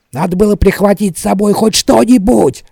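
high, loud, irritated retort; snapping back. A narrator's reading. Smed retorting to Tally in the dark, snappish. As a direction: snap back at a nagging companion. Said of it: "огрызнулся Смет".

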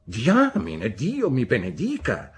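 the aunt scolds the weeping mother; exasperated exclamation. A narrator's reading. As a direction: throw up your hands in exasperation. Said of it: "commentò Miss Betsy".

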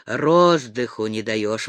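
low, slow, sullen grumble; a surly complaint, dragged low; gloomy grumble. A narrator's reading. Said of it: "ворчал угрюмый".

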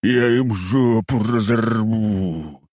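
slow furious growl of rage; shaking with rage, drag it out; furious bear. Acted. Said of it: "трясло от ярости".

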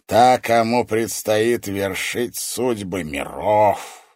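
slow build to a high, loud exclamation; gloating sorcerer. A narrator's reading. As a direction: relish the irony word by word.

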